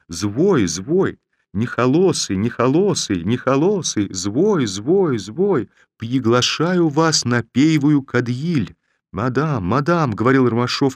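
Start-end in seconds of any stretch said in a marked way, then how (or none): 0.1-5.7 "звой" and "нехолосый" with r and r-sounds swapped, high and teasing; says w for r. A narrator's reading. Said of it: "разнообразно картавя". 6.0-8.7 "пейвую" with a burred r, high and slow; says w for r. A narrator's reading. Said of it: "разнообразно картавя". 9.1-10.1 higher, courtly mock gallantry; bow and play the polite gentleman; gallant cavalier. A narrator's reading. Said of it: "изображая собою против воли любезного кавалера".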